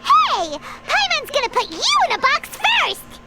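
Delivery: squeaky, mock-threatening retort with swooping pitch; indignant outburst. Companion teased about being gift-boxed, indignant squeaky retort. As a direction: snap back like an offended little sidekick.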